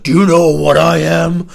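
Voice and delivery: imitating a drunk friend roaring he's a Viking; Viking boast. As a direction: bellow it like a drunk warlord. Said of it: "and he's like".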